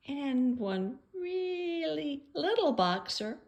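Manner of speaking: "really little" in a high, slow, small voice; tiny-sounding words. Shown as a demonstration. Describing the little boxer in the picture, high and tiny.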